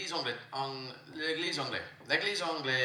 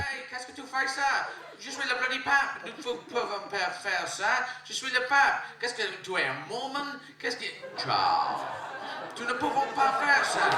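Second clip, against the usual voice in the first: angry pope; 10 semitones higher than usual. Acted. Comedian voices the pope refusing the king, high and outraged. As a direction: play the pope scolding the king, high and indignant.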